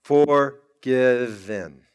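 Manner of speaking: a very slow, weighty Forgiven; drawn-out revelation. Acted. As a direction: stretch the word out slowly and low. Answer to the tombstone question.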